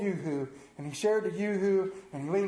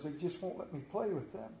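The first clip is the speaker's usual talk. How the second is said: put-on quiet hurt child; 5 semitones lower, 11 dB quieter than usual. Son's hurt words retold.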